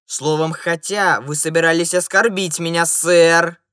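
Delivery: offended gentleman growling loudly; angry growl. A narrator's reading. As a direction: growl it as an affront. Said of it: "прорычал господин в сером цилиндре".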